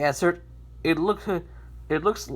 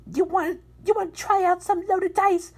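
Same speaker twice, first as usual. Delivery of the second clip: high, oily sleazy pitch; 13 semitones higher, 5 dB louder than usual. Acted. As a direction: sell the cheat with a greasy grin. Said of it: "said as, uh, gonna sleazy, uh, sleazily".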